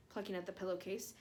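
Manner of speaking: plain reading voice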